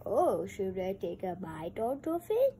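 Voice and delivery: a hint of a sneaky shark. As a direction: tempt the shark to bite the book. Shark wants to bite book.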